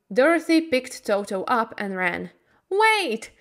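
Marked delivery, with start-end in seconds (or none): 2.7-3.3 high cry to the rising balloon; shouting. A narrator's reading. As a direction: call desperately, high. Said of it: "she shouted".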